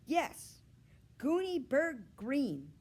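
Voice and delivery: high, loud, slow group call; class calling out. Acted. Kids calling out to their classmate, loud and drawn out. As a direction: call it out together like a whole class. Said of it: "the class called".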